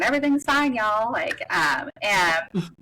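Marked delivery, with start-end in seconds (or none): 0.0-1.1 put-on Southern accent. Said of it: "that Southern".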